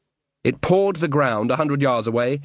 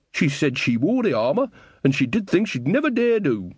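lightly voiced chatty old woman; old gossip woman; 6 semitones higher than usual. A narrator's reading. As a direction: gossip cheerfully, a bit higher. Narrator voices Nanny Ogg.

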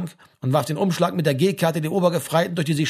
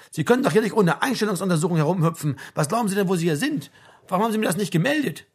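higher, pressing sergeant's reprimand; indignant army sergeant; 5 semitones higher, 4 dB louder, faster than usual. Acted. Sergeant asks why it was not reported, indignant. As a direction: demand an explanation from the conscript.

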